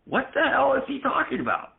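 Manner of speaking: high, puzzled passerby voice; mock passerby. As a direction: play a baffled passerby sneering. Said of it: "like, saying".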